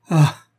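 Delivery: sharp, loud "ah" cry. A narrator's reading. Woman covers her face in distress, crying out. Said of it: "s'écria-t-elle".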